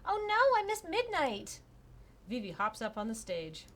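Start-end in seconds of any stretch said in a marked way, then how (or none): 0.0-1.6 high, loud panicked cry; suddenly realizing the time, alarmed; oh no, high and startled. Acted. Character dialogue in visual novel.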